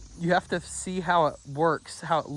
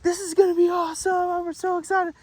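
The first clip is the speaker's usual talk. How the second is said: put-on high, fast, gushing camp kids; excited kids; 14 semitones higher, 4 dB louder, faster than usual. Recalling eager camp kids on the bus, mimicked high and fast. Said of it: "They're like".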